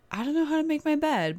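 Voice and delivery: playful exclamation. Real, not acted. Self-mocking exclamation.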